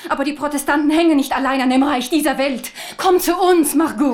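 loud, rising, passionate appeal; fervent plea. Acted. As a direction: preach it to win her over. Queen pleads fervently.

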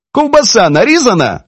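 high-pitched woman asking briskly; woman's question. A narrator's reading. Narrator voices woman's question.

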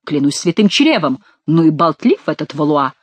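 ordinary delivery, nothing marked

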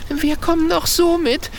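high, trembling and pleading; a frightened girl's shaky, high voice. A narrator's reading. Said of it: "sagt Mathilda mit zitternder Stimme".

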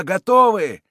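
loud, high reply, falling at the end; crowd's answer. A narrator's reading. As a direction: call back that all are ready. Mob answers its commander.

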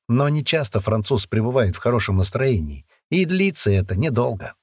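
ordinary delivery, nothing marked

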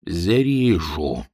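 медленно, низко и мрачно; тяни слово упрямо и мрачно. A narrator's reading. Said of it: "твердил своё Гладышев". (глухое упрямое «зарежу»)

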